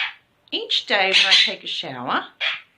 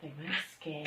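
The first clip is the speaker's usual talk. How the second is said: ordinary talk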